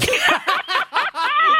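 high-pitched burst of laughter; high cackling laugh in imitation; imitated laughing. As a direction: act out his laughing fit, high. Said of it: "da fing er an zu lachen".

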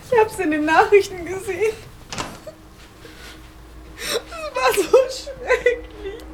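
tearful. Acted. Grieving girlfriend hears of death.

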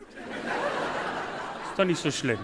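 put-on slow soft whisper to a child; soothing whisper. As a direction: hush a crying child gently. Comforting crying children.

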